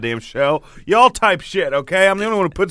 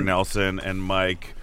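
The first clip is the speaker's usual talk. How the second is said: low and drained; 7 semitones lower, 6 dB quieter, slower than usual. Real, not acted. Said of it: "you sound like you got out of rehab".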